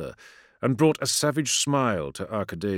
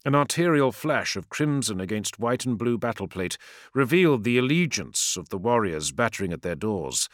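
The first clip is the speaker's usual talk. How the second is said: ordinary delivery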